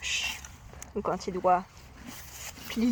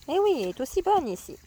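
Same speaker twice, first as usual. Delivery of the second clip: coaxing horse; 6 semitones higher, 4 dB louder than usual. Real, not acted.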